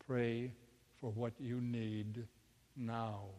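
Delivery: soft, low, slow and measured; calm tone. Retold or imitated. Inner voice instructing a panicked mother, soft and calm. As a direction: repeat it with calm, unhurried pauses. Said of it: "The voice said again in its calm tone".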